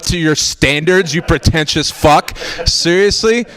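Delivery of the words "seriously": ordinary talk, nothing marked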